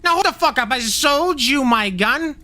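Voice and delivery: indignant Jersey tough guy; South Jersey accent. Pretend. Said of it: "I like the accent".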